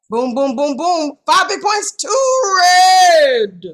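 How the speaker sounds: building hype call, ending in a shout; hype announcer. Acted. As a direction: pump up the crowd like a sports announcer. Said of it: "Announcer [name]".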